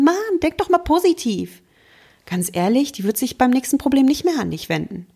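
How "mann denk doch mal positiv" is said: much higher, sing-song brush-off; mock the empty pep talk; mock dismissive cheer. Friend brushing off a sad friend in a high sing-song. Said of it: "und du sagst dann".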